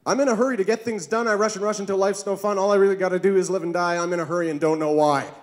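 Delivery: fast, rushed, pushy man's voice; a busy man racing through his day, fast; hurried typical man. Acted. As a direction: gabble it out like a man with no time. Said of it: "Here's my impression of a typical man".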